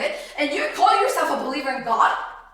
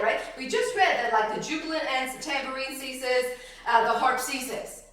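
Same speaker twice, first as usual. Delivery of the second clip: usual speaking voice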